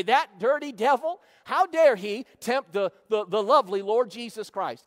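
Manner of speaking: hammed-up righteous anger; mock outrage. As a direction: stomp and shout it like an offended churchgoer. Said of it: "stomp our feet and say".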